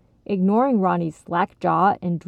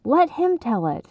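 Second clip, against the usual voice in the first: put-on higher-pitched kid's reproof; impatient telling-off; scolding; 6 semitones higher than usual. A narrator's reading. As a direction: scold a friend for interrupting. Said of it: "Ronnie scolded".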